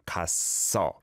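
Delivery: 갔어 with a tense doubled s held on the first syllable, then carried into 어; 갔어 pronounced with a tight, tense ss bridging both syllables; careful model pronunciation. Shown as a demonstration. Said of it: "And then blends over to the second one".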